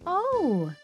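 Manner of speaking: high, loud delighted "oh"; delighted surprise. Acted. Girl opens Grandma's parcel, delighted surprise. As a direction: exclaim with rising delight.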